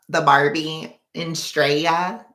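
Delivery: slow, drawn-out mock Australian "The Barbie in Straya"; mock Australian accent. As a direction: stretch "Straya" out like a hammed-up Australian. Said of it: "they probably hate the way I just said that".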